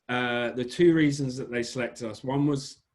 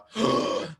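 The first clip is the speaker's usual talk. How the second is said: imitated gasp; 5 semitones higher than usual. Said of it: "And she was like".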